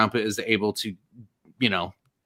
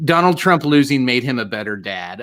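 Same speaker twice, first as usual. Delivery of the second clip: ordinary talk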